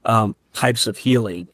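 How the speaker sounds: usual speaking voice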